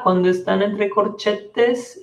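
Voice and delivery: usual speaking voice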